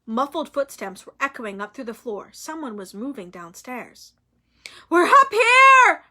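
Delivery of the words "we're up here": very high, loud, slow scream; desperate scream. A narrator's reading. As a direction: scream for help upstairs. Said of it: "Hermione screamed".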